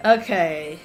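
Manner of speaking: ordinary talk, nothing marked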